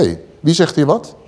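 ordinary talk

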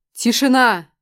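loud commanding shout; stern shout. A narrator's reading. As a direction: bark for silence on set. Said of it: "грозным окриком".

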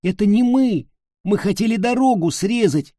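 pleading. A narrator's reading. Caught boy pleading, high.

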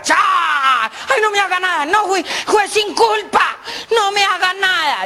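aguda, lenta, gritando de miedo; súplica asustada. Acted. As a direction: ruega aterrado como un pobre jorobado. Jorobado asustado ante calavera. (súplica aguda y lenta con miedo)

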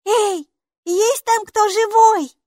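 put-on high, slow child's voice calling into the dark; little girl calling. A narrator's reading. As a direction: call gently into the tunnel. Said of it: "Негромко позвала Майки". (тихо зовёт в темноту)

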